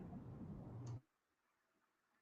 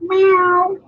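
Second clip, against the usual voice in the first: high, louder meow to call a cat; high coaxing cat meow; 12 semitones higher, 4 dB louder than usual. Pretend. Calling the cat.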